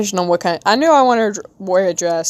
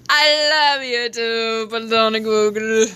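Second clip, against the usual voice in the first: slow sweet higher voice; cutesy reply; 3 semitones higher, slower than usual. Acted. Streamer answering a viewer's message, cutesy. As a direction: coo it back to the viewer. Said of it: "I love Platonical Pookie Bear".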